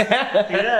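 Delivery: a bright laughing yes, high up; laughing yeah. Real, not acted. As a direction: laugh through the word. Host laughs at guest's joke.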